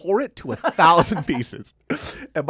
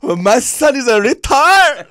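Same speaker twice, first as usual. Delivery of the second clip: high, loud, slow mocking wail; hammed-up outraged mother; mock mom complaining; 11 semitones higher, 12 dB louder, slower than usual. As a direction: parody her complaining to friends. Mom's phone call mocked.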